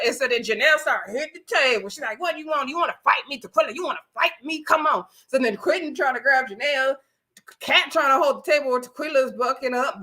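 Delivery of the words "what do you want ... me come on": higher-pitched retold fight challenge; mock confrontation. Retelling one cast member daring another to fight, mock combative. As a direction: goad a rival, acted out without shouting. Acted quarrel, Janelle challenging Tequila.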